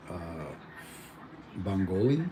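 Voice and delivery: usual speaking voice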